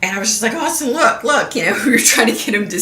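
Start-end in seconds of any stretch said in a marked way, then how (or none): 0.5-1.5 put-on high, eager call to a toddler; excited calling out. Mom calling her toddler to see the tiger, high and eager. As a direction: point it out with delight.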